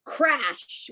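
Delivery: a high, punchy "crash"; exclaimed crash. Shown as a demonstration. Teacher voicing comic-book sound words for the class. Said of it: "these are loud sounds".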